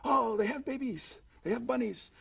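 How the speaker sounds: audience call-out. Retold or imitated. Audience calling out the joke, mock excited. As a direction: play the crowd shouting the punchline. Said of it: "They yell out".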